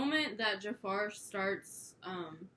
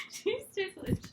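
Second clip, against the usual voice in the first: laughing; 13 semitones higher than usual. Real, not acted. Laughing while petting dog.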